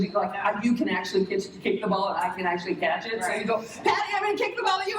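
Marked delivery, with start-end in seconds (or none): 3.8-5.0 put-on high, fast, eager kid's voice; child player. A six-year-old calling to a teammate in a high, fast kid voice. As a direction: call out like an excited little kid.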